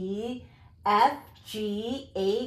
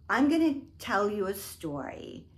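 ordinary delivery

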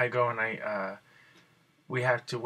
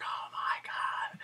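slow, breathless whisper of awe; awed whisper; 3 dB quieter, slower than usual. Retold or imitated. Watching Defying Gravity, an awed slow whisper. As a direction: whisper it, stunned by the show. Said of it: "you're like".

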